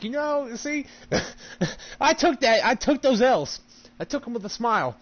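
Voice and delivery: high, sing-song fake humility; mock gracious loser. Gamer pretending to lose gracefully, in a high mock voice. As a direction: play the good sport with a high put-on voice.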